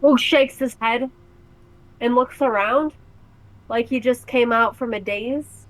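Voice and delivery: ordinary delivery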